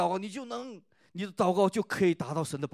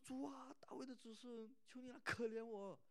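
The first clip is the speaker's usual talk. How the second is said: much softer, higher pleading near-whisper; hushed plea; 7 semitones higher, 18 dB quieter than usual. Retold or imitated. Pastor voicing the Canaanite woman begging Jesus, hushed and pleading. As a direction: beg the Lord in a hushed voice.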